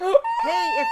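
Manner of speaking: high startled shout; sudden shout. Real, not acted. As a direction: burst out loud and high. Sudden outburst after gasp.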